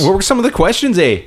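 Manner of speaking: high, hammed-up Canadian accent with a closing eh; mock Canadian accent. Host reads the next question, mock Canadian accent. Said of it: "talk in an accent".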